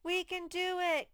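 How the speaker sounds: put-on children cheering as a team. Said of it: "these kids are yelling".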